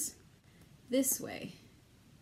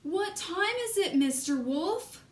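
excited call; 6 semitones higher than usual. Acted.

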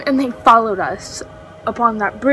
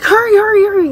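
high, louder re-enacted urging; retold urgent calling; 8 semitones higher, 4 dB louder, faster than usual. Trying to get friends all together, urgent retold calling. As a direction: hurry them along, voice jumping up.

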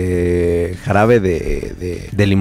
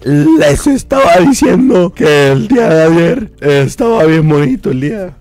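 higher, louder stammering crooner voice; 5 semitones higher, 5 dB louder, much slower than usual. Retold or imitated. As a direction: stumble through it like a croaky old singer. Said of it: "señor [name], hable bien".

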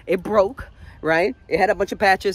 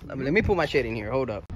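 ordinary talk, nothing marked

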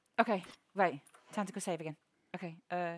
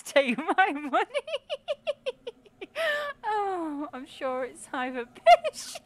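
words squeezed out through laughter, slow and louder; laughing hard; 10 semitones higher, 8 dB louder, much slower than usual. Real, not acted. Streamer cracking up at chat, laughing. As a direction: giggle through the words helplessly.

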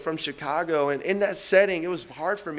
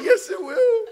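high, laughing answer; laughing yes; 6 dB louder than usual. Retold or imitated. Answering his friend on the train, laughing through tears. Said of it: "I'm like".

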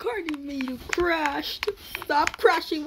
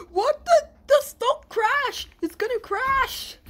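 alarmed; 10 semitones higher than usual. Real, not acted. Cart about to crash.

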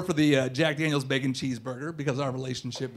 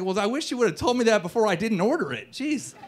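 high-pitched acted annoyance; exasperated; 5 semitones higher than usual. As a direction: complain with pitch jumping up. Acted complaint to waiter over refills.

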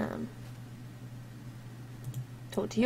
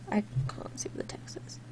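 hushed aside to himself; soft whispered complaint; 15 dB quieter than usual. Real, not acted. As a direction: whisper it, barely voiced. Aside while reading the screen.

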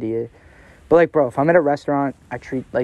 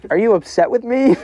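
high mock-sweet question; 9 semitones higher, 4 dB louder than usual. Retelling apology to the hostess.

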